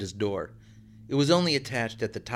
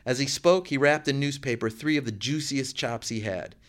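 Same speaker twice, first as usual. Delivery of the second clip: ordinary delivery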